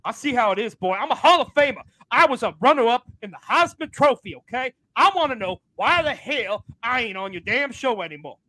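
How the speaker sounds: barge in furious, demanding answers; angry Ron. Acted. Ron crashing the show to yell at the host, high angry voice. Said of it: "He sounded pretty angry".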